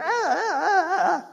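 high-pitched mock child whining; drawn-out imitation of a whining child; whiny kid wail. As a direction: wail like a nagging little kid. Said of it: "those kids that whine a lot".

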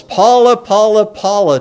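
"Paula, Paula, Paula" high and loud, stepping down; emphatic triple repetition. Shown as a demonstration. Preacher showing Hebrew's strongest form, emphatic triple "Paula". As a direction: pound out "Paula" three times, strongest first. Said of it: "as strong as you can make it".

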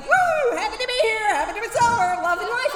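high, giddy cheer that starts with a squealed "Woo"; mock bubbly newcomer. Mocking a happy member at meetings, giddy and high. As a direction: parody of someone happy, joyous and free.

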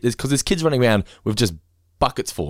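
usual speaking voice

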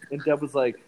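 ordinary talk

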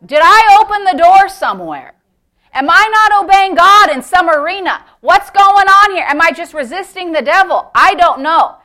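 high, forceful searching prayer; urgent questioning prayer. Acted. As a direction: plead loudly for an answer.

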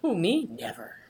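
deadpan sarcastic denial. Real, not acted. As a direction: say it with heavy irony.

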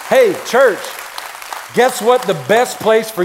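high, loud rallying preacher; full-force preaching. Acted. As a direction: fire up the congregation. Pastor hyping the church campus.

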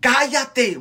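high, sudden crowd shout; sharp narrated yell to silence him; shouted rebuke. A narrator's reading. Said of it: "muchos le gritaban".

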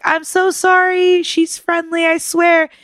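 high, slow apologetic plea; apologetic pleading. Retold or imitated. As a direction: beg her pardon, a bit slowly and high. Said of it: "I was like".

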